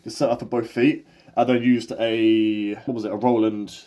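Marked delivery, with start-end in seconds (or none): none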